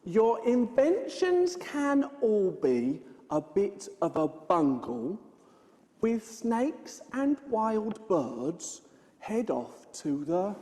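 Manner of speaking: slow, low, deliberate riddle reading; reading a clue. Acted. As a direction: leave the last word hanging for guesses.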